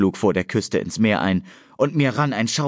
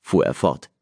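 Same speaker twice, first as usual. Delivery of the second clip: usual speaking voice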